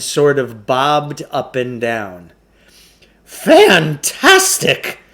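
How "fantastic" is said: much higher, slow drawn-out exclaim; amazed huckster. A narrator's reading. Shopkeeper amazed at the boy's result, high slow exclaim. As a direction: gush like a showman stunned. Klugarsh voiced in story.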